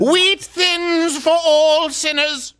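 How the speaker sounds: very slow, grand proclamation; booming biblical narration. Pretend. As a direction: intone it like scripture. Mock scripture.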